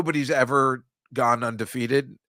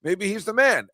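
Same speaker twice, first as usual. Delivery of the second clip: much higher, mock-impressed voice; mock amazement; 8 semitones higher, 3 dB louder than usual. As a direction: play fake awe, pitched way up. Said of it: "you're like".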